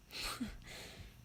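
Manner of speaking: soft, airy little laugh; scornful chuckle. Acted. Castle villain amused by a stupid intruder, soft scornful chuckle. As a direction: quietly laugh off the fool.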